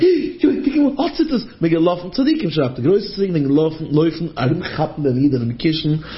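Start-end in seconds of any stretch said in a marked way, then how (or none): 0.0-1.5 excited high call. Retold or imitated. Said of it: "und sagt".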